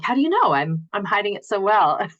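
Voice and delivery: high, loud mock surprise; mock disbelief. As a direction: exclaim in playful shock. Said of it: "And I'm like".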